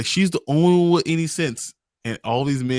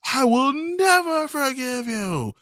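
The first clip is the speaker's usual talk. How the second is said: way higher and slow on never forgive; high mock vow; 12 semitones higher, slower than usual. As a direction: stretch it out in a hammed-up high voice. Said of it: "Pedrick is like".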